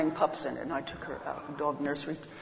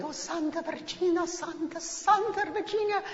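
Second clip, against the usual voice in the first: put-on old lady in rapture; 11 semitones higher than usual. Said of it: "the old lady's going".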